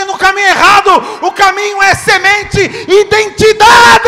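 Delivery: full-voiced preacher's shout, slowed on the last word; preaching shout. Real, not acted. Pastor crying out identity to the congregation, shouting.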